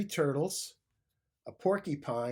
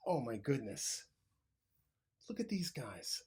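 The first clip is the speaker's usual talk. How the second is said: whispered aside; 6 dB quieter than usual. A narrator's reading. Narrator confides to listeners in a whisper. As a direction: lean in and whisper it.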